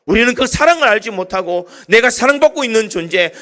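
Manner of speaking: fervent sermon build; preaching at full force. Acted. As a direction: preach it out to the congregation with fervour.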